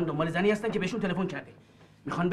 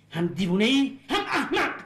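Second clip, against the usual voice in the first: angry insult; 6 semitones higher, 4 dB louder, slower than usual. Acted. Insulting her in a quarrel.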